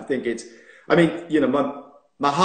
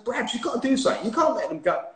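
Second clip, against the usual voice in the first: urgent plea; 9 semitones higher, faster than usual. Retold or imitated. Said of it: "I'm saying".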